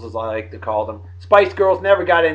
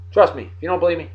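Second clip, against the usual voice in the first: usual speaking voice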